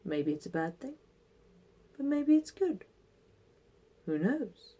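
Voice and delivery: put-on calm, measured old man voice; wise old sage. Old man repeats his saying.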